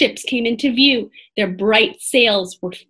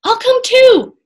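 high, loud, eager shout; crying out; 13 semitones higher, 9 dB louder than usual. A narrator's reading. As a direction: call out to join in. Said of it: "cried Annie".

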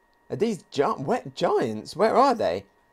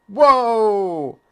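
excited whoa; 6 semitones higher, 6 dB louder than usual. Real, not acted. Reacts to big explosion panel.

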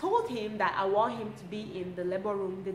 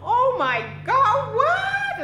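much higher and louder, slow stunned cry; shocked exclamation; 12 semitones higher, 8 dB louder, much slower than usual. Retold or imitated. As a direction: disbelief bursting out, drawn out. Shocked at the water bursting.

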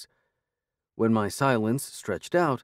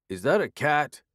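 loud; 6 semitones higher than usual. A narrator's reading. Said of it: "he asked, loudly".

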